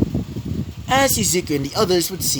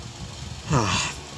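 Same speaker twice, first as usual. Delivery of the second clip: soft, breath-only sigh; resigned sigh; 5 semitones lower, 7 dB quieter than usual. A narrator's reading. Izuku giving in before hosting everyone, soft sigh.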